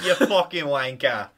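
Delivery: put-on British insult; mock British accent. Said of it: "add some British to it".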